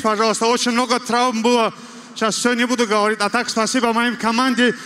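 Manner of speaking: ordinary delivery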